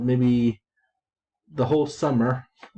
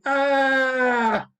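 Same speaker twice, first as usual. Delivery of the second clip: mock shocked scream; 10 semitones higher than usual. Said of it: "shocked and screaming".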